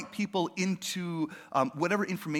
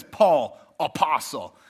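louder, proud self-announcement; a touch of swagger; chest-thumping intro; 3 semitones lower, 6 dB louder, slower than usual. Retold or imitated. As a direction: puff up and announce yourself. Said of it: "thumping your chest".

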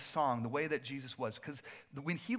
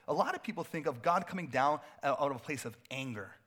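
usual speaking voice

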